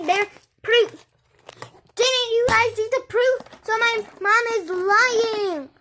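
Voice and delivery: loud, fast and insistent. Real, not acted. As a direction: shout your proof to the viewers. Kid shouts proof to camera.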